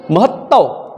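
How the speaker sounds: महत्व as mahattav with a held double t. Shown as a demonstration. Teacher points out a spelling error, महत्व with double t. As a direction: hold the t in महत्व and end in -av. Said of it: "यहाँ पे double त आ गया".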